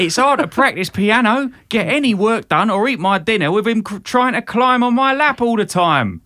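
hammed-up Britpop South London; really [name] it up, loud and high; South London accent. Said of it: "I'll do the South London".

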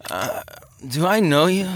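put-on drunken, uncertain question sliding upward; slurred drunk. A narrator's reading. Drunk man squints at the newcomer, slurring. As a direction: slur it, woozy and puzzled. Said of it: "he slurred".

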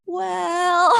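high, drawn-out sheepish "well"; guilty hedging. Retold or imitated. Teenager confessing to a sip of alcohol, exaggerated sheepish hedging. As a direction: squeal it as a comic admission.

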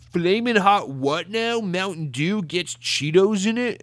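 high, slow, hyped-up video-title voice; mock a clickbait host's fake amazement; drawn-out, exaggerated YouTuber imitation; annoying YouTuber. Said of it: "these annoying videos".

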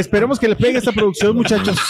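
high, amused talk through laughter; real laugh in the voice. Real, not acted. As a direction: wish it well while chuckling. Teasing wish amid laughter.

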